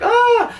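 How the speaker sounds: sudden high yelp of panic; mock panicked cry, shooting up high; imitating a panic-attack gasp.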